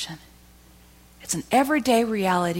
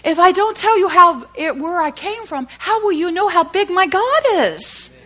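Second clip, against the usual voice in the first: much higher and louder, slow start; Connie's challenge; 13 semitones higher, 9 dB louder than usual. Retold or imitated. Connie asking the host why he's crying, fervent and high. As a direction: hurl her question back with fervour. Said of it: "And she says".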